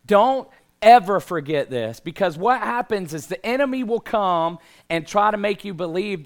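loud, high, building sermon intensity; preaching. Real, not acted. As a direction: preach it with full force. Preaching at full force.

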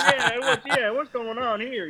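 high Southern voice, put on; mock Southern accent. Southerner questioning the visitor from up north, mock Southern accent. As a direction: imitate a puzzled Southerner, pitched high. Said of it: "down south, they'll be like".

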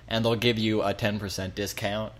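usual speaking voice

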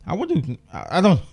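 hoarse, fading voice; losing voice. Real, not acted. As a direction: voice giving out mid-sentence. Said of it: "I'm losing my voice here".

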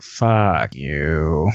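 low, drawn-out retold dismissal; low mock contempt; disgusted look voiced. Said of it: "gave me that look like".

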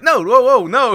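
mock-alarmed doctor calling him off; startled doctor's stop. Doctor stopping him abruptly.

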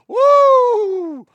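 high loud excited whoop. Real, not acted. Host reacting to a cheeky message, loud whoop. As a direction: let out a wild cheer.

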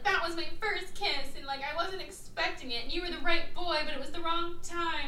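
hammed-up high girlish sincerity; imitated earnest girl. Acting a girl's earnest confession.

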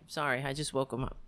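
ordinary talk, nothing marked

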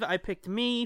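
ordinary delivery

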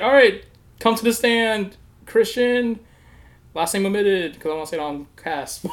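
mock-formal summons to the stand; court official. Voicing the court representative.

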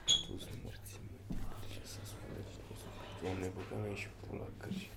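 hushed, breathy whispered swearing; whispered cursing. Real, not acted. Grumbling to friends about the cameraman, whispered curses. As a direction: curse under your breath so nobody hears.

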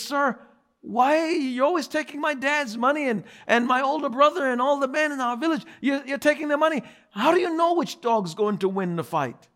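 put-on high, fast voice of a curious little boy. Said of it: "The little boy says".

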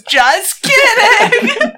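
high, slow, loud squeal of just kidding; high squeaky just kidding. Acted. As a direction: squeak it out slowly like a silly prank. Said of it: "and he goes".

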